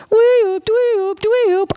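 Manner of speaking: repeated very high, loud-starting mock alarm; high siren whoops. Teacher twitching at the leading tone, high siren whoops.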